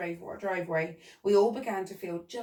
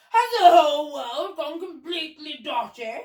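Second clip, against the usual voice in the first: high, loud, aghast Duke; bellowing; 7 semitones higher, 10 dB louder than usual. A narrator's reading. Duke thinks the world gone mad, bellowing. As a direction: bellow in disbelief. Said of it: "he bellowed".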